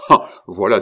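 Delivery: loud indignant outburst; irritated. A narrator's reading. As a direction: burst out in irritation. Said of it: "L'abbé s'irrita de nouveau". (irrité, éclat de voix)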